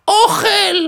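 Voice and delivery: high squawked parrot word. Acted. Parrot's only word, punchline. (תוכי צורח בקול גבוה)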